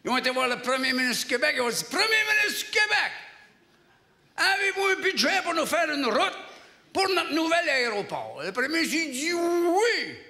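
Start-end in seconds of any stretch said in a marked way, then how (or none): 1.9-8.4 high, emphatic delegate's appeal; mock official petition. Mayor's delegation asks Quebec's premier for money, high and proclaiming. 9.3-10.2 high, long-held comic yes; drawn-out agreement. Acted. Premier answers the airport delegation, high and drawn out.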